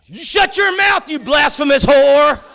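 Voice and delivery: high, loud, outraged yell; furious shout. Retold or imitated. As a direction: roar it like a sacred hero was insulted. Outraged defense of John Wayne.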